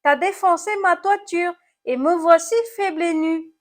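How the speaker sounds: high, loud snail lament; moaning complaint. A narrator's reading. Snail blames apple for its smashed roof, moaning. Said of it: "gémit l'animal cornu".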